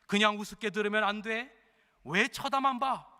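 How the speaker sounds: normal talking voice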